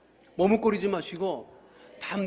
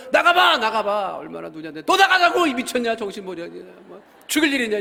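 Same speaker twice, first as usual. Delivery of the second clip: retold yelling; 3 semitones higher, 9 dB louder than usual. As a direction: rant at the family, fed up. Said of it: "막 소리 지르니까". (가족에게 소리 지르는 아빠, 화난 고함)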